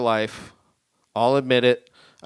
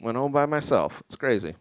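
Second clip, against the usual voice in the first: ordinary talk, nothing marked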